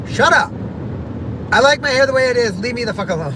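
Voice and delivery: high, teasing, affectionate snap; telling off her cousins, playful and fond; loving cousin way. Retold or imitated. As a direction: tell them off with a grin. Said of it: "But I did it the loving cousin way".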